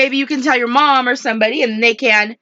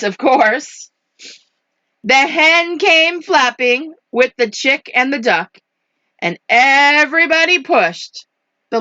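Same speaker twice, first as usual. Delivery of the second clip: animated, rhythmic picture-book narration; lively story voice, key words stretched; story reading to children; 2 semitones higher than usual. A narrator's reading. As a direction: bounce through the rhyme. Reads picture book aloud.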